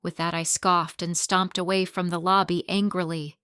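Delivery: ordinary delivery, nothing marked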